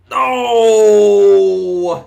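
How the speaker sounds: loud high cry of despair; anguished shout. Real, not acted. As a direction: yell it out in real despair. Big play goes wrong.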